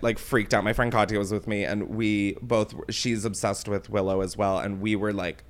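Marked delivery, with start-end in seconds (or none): none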